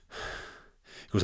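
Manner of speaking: ordinary talk, nothing marked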